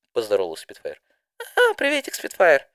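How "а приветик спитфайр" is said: bright squeaky greeting. Acted. Didi greets Spitfire.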